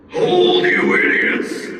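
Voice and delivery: high, loud call, slowing on the insult; Pontiff calling out. Acted. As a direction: scold the idiots from afar. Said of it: "Pontiff called".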